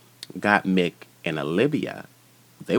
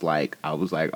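ordinary talk, nothing marked

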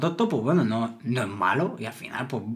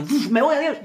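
mock quick exit; 10 semitones higher than usual. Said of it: "ha hecho".

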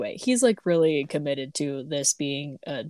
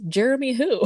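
a mock panicked, higher question; freaked out; 6 semitones higher, 4 dB louder than usual. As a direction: imitate someone panicking on the phone. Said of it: "Candace immediately freaks out and goes".

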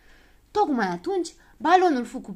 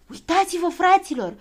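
high, loud call; alarmed shout; 9 semitones higher, 7 dB louder than usual. A narrator's reading. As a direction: warn your friends excitedly. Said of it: "strigă el".

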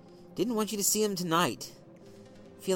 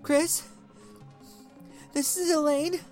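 much higher, trembling voice; fighting to hold it together; wife calling a friend, shaken; 14 semitones higher than usual. A narrator's reading. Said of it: "voice communicated more than her words".

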